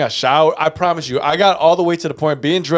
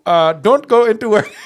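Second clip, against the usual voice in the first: laughing; 7 semitones higher than usual. Real, not acted. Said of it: "laughs while retelling".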